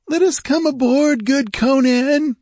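very high, loud, pleading whine; wheedling high-voiced pirate; whining pirate. A narrator's reading. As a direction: whine to be let aboard. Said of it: "whined a red-sashed Zamorian".

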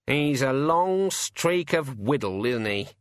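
a mocking bird voice, slow; a cheeky raven sneering from above; sardonic raven. A narrator's reading. Raven comments from branch.

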